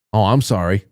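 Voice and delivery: usual speaking voice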